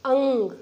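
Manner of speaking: अंग said with a pushed nasal ng; stressed nasal demo. Shown as a demonstration. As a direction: press hard on the nasal in अंग. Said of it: "आप देखिए जोर लगाना पड़ रहा है". (अंग पर ज़ोर देकर नासिक्य उच्चारण)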